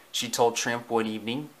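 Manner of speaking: ordinary delivery, nothing marked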